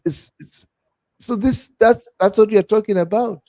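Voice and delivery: usual speaking voice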